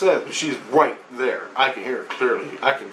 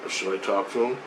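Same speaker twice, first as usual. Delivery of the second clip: quiet, hesitant, softer aside; timid hushed question; 5 semitones lower, 7 dB quieter than usual. Retold or imitated. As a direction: ask it under your breath, a little scared. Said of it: "I'm too afraid to say anything".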